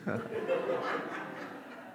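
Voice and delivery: soft unvoiced laugh; real amused chuckle; breathy laugh. Real, not acted. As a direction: laugh quietly to yourself. Laughing at his own story.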